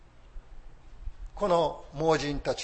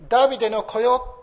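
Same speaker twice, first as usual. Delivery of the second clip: louder, higher call; preacher voicing a believer's loud call; 8 semitones higher, 10 dB louder than usual. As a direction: call out to the Son of David. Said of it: "と呼ぶ者".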